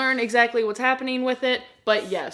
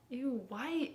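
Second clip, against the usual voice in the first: ordinary delivery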